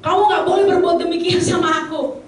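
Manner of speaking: high, indignant protest; self-important. Acted. Preacher mimicking a touchy believer who feels superior, indignant. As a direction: act out someone who thinks too much of themselves. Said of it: "ngerasa si paling".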